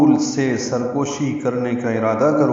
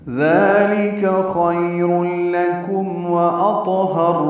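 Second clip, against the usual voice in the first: slow melodic chant of the verse, higher; Quran recitation; 6 semitones higher, 4 dB louder, slower than usual. Acted.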